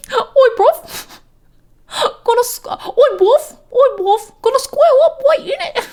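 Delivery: very high, squeaky British cartoon voice; giggly falsetto with a mock British accent; British Mickey Mouse. Streamer repeating the bit for laughs, squeaky British cartoon voice. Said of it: "British Mickey Mouse".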